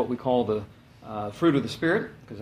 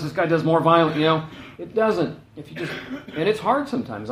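normal talking voice

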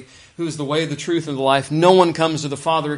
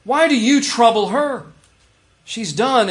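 higher and louder protective rebuke; Jesus defending the woman, angrily challenging her critics; angry; 6 semitones higher, 6 dB louder than usual. Retold or imitated. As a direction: demand it of the accusers. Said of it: "he's angry, defending her".

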